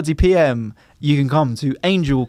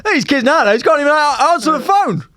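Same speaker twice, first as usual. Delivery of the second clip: put-on grumpy old-timer; 10 semitones higher, 3 dB louder than usual. Grumpy old man impression.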